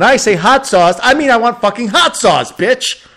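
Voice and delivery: loud, high, hammed-up rage; mock-furious shout. Customer demanding hot sauce, shouting in mock fury. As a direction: yell it like an outraged customer. Comic outburst as enraged customer.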